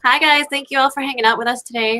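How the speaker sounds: ordinary delivery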